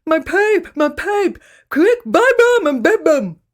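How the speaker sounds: "buy bum and bed bum" yelled high and loud with no teeth; toothless shout. Acted. Grandad begs the girls to fetch his teeth, toothless yell.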